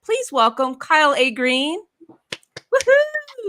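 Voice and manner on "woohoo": high cheering whoop; bright excited cheer; excited woohoo. Real, not acted. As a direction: whoop to welcome him on stage. Host cheering guest's entrance.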